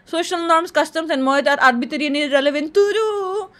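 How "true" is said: high, sustained sing-out answer; drawn-out verdict. Pretend. Answering a personality quiz, high and drawn-out. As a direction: stretch the vowel on one held note.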